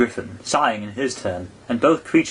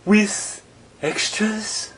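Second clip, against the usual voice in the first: a little anxious; 10 semitones higher, much slower than usual. A narrator's reading. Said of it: "a little anxiously".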